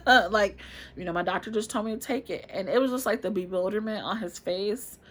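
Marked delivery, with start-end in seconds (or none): 0.0-0.6 louder, a short embarrassed laugh; laughing at herself over the pills. Real, not acted. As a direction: laugh it off awkwardly. Laughs at the awkward moment.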